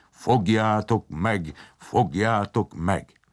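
slow, drawn-out booming command; heavy bellow, each syllable stretched. A narrator's reading. Steam man sets the dumplings on Palkó, bellowing. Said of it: "a gőzemberke meg bömbölt".

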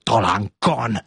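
understated hissing contempt; mildly hissed sarcasm; hissed retort. Acted. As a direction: hiss it lightly, as a scornful correction. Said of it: "sziszegte a király".

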